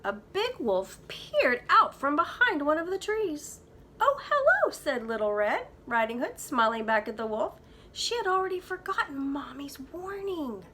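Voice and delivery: put-on lively read-aloud for kids; story narration. A narrator's reading. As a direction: tell it like a bedtime storybook. Teacher reads story aloud.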